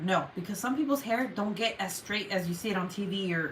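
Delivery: ordinary talk, nothing marked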